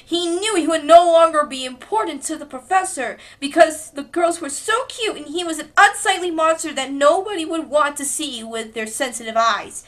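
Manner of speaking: ordinary talk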